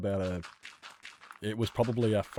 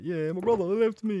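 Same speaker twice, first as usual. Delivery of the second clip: put-on high, loud child voice; a kid tells the driver his brother left him; little kid voice; 12 semitones higher, 7 dB louder than usual. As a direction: speak as a small boy left behind. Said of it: "I was just like".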